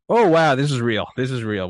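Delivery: loud, high burst easing off; startled alarm. Acted. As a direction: act out the jolt of sudden danger. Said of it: "sometimes you do get a little scared".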